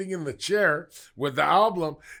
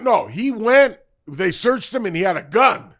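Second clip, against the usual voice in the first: ordinary talk, nothing marked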